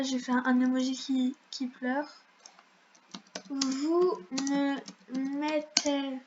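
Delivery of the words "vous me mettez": word by word with long pauses; halting, spaced-out phrase; typing dictation. Real, not acted. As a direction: spell out the sentence at typing speed. Says words while typing them.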